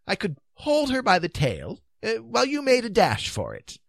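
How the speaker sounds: high, hesitant offer; doubtful. A narrator's reading. King offering to hold the beast, doubtful. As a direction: suggest it uncertainly in a high voice. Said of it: "he said doubtfully".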